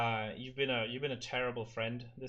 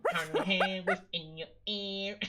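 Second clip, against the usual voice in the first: nasal [name] voice; 7 semitones higher, 4 dB louder, slower than usual. Retold or imitated. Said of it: "nasal [name] voice".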